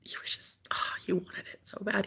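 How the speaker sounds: breathy hushed whisper. Real, not acted. Telling viewers about last night, in a whisper. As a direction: whisper it like a secret.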